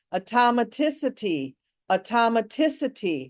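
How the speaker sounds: automaticity repeated in an even, falling rhythm, stressed on "tic"; repetition drill. Shown as a demonstration. Teacher leading learners ten times, automaticity as a steady rhythmic drill. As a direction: drill automaticity evenly, the same tune every time. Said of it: "Ten times with automaticity".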